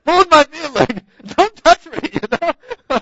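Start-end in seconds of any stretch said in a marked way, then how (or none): 1.4-2.1 loud, high mock panic; mock panicked shout. Preacher pretending a woman touches his feet, mock panicked shout. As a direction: shout it like someone is grabbing you. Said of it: "I'd be like".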